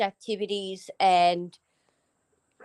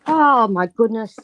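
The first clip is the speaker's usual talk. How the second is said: louder startled oh my goodness; startled by the vacuum eating a sock; surprised exclamation; 8 dB louder than usual. Real, not acted. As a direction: react as it eats the sock. Vacuum just ate a sock.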